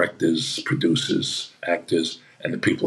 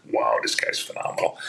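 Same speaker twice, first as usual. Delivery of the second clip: awed aside; 9 semitones lower than usual. Retold or imitated. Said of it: "I was like".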